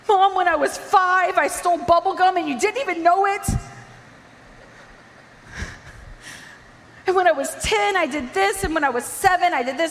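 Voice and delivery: high, urgent outpouring; pouring out confession. Retold or imitated. Daughter confessing every childhood wrong to mom, pouring it out. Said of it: "I just started vomiting everything".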